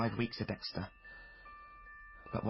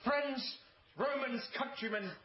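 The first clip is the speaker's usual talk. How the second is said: high, grand theatrical declaiming; over-the-top Mark Antony oration; hammy Shakespeare; 13 semitones higher, slower than usual. Acted. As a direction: declaim it like a ham actor on stage. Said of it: "Julius Caesar?".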